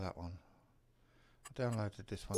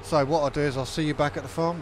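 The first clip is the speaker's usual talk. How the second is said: upbeat sign-off; 6 semitones higher, 10 dB louder than usual. Real, not acted. Outro sign-off to viewers.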